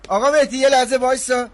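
loud call after someone walking away. Acted. Calling after Mehdi as he storms off, loud. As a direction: shout his name so he stops.

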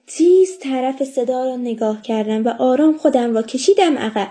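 ordinary delivery

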